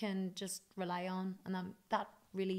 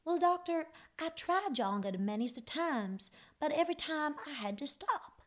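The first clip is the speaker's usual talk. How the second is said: sweet Tennessee twang deadpan; 7 semitones higher than usual. Retold or imitated. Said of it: "And [name] was like".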